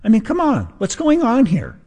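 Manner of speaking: high-pitched mock-exasperated pundit; hammed-up high incredulous whine; Tucker Carlson. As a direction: squeak out baffled outrage, imitating a TV commentator. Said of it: "I just sounded like Tucker Carlson".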